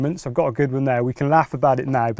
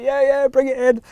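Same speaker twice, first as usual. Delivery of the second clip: much higher, excited call; urging a friend to land the fish, louder; eager mate; 13 semitones higher, 4 dB louder than usual. Retold or imitated. Mate on the bank urging the net in, high excited call.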